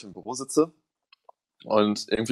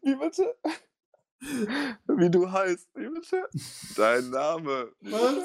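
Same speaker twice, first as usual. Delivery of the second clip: confused guest; 7 semitones higher, much slower than usual. Retold or imitated.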